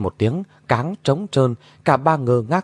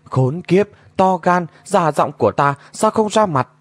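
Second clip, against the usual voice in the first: higher-pitched copy of an angry woman; exact mimicry; 7 semitones higher than usual. A narrator's reading. Unseen mimic in the palanquin repeating the goddess's words, high angry mimicry. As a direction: echo her curse back in her own heated voice. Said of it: "có tiếng trong cáng vọng ra hệt giọng của nàng".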